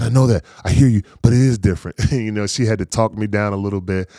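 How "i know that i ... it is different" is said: louder, higher, quick retold rebuttal; insistent protest. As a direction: cut in to argue the point, re-enacted. Retold protest to his wife.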